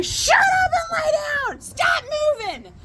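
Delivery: very high, screeching mock shout; retold yelled order. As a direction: re-enact yelling frantic orders at an injured parent. Retold yelling at injured dad.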